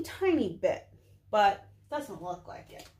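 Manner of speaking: ordinary delivery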